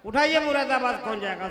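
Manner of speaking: loud call to raise hands. Real, not acted. Host urging the crowd to raise hands, loud. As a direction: shout it across the crowd.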